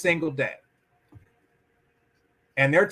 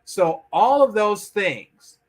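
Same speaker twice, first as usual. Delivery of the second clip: ordinary talk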